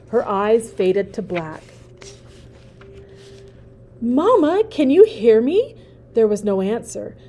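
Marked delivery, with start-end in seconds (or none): 4.0-5.7 high, pleading little gosling calling out; young gosling begging mother to wake; anxious gosling. Acted. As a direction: call to mother, scared. Gosling calls to lifeless mother.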